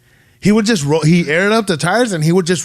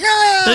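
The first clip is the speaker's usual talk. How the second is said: mock shriek of a startled mom, much higher; startled scream. Mom spotting a masked kid at the window, imitated scream. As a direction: jump and let out a sharp imitated scream.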